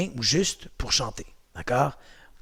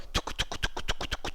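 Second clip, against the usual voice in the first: soft unvoiced tongue clicks, touc touc; clicking coaster chain; 12 dB quieter, slower than usual. Instructor imitates the coaster climbing for the class, soft clicks. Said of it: "Un peu comme".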